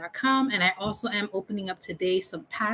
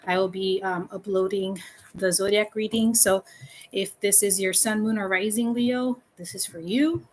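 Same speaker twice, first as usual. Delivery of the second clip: ordinary talk, nothing marked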